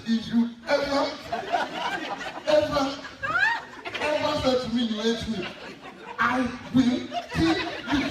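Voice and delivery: loud, high scolding mother; hammed-up warning, hammer each phrase loudly; mock furious threat. Mom warns child never to say it, loud mock threat.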